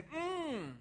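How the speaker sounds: long, high hum of food enjoyment; appreciative "mm". Acted. Preacher acting out a diner enjoying food, savouring.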